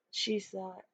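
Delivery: ordinary delivery, nothing marked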